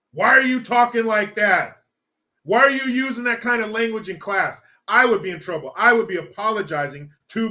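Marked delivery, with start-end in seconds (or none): 0.1-4.6 put-on louder parent scolding a child. A parent scolding their kid over classroom language. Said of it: "My parents would say".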